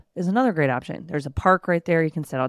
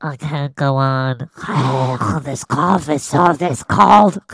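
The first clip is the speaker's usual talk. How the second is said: hammed-up whiny sick man with sighs; mock dying husband; 2 semitones lower, 3 dB louder, slower than usual. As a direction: overdramatic groaning cold victim. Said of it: "just like".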